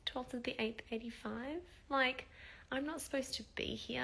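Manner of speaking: retold sad self-talk in a small high voice. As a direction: say it like a quiet lament to yourself. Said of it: "I just got really sad".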